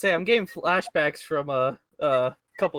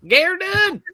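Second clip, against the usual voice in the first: very high, loud catchphrase yell; Larry the Cable Guy; 7 dB louder than usual. Acted. Contestant echoing the comedian's catchphrase, high loud impression. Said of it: "Who is Larry the Cable Guy?".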